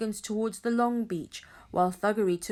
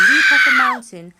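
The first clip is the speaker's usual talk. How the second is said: yelled word; 3 semitones higher, 15 dB louder than usual. A narrator's reading. Narrator describing a jump off a mountain, yelled word.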